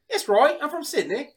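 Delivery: high, put-on Australian mate; Australian accent. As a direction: play along in a mock Aussie accent. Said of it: "Are you from Australia?".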